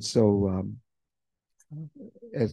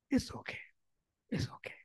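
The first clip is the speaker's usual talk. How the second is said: soft whispered comfort; soothing whisper; 12 semitones higher, 10 dB quieter than usual. Acted. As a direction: hush them like a baby. God soothing like parent.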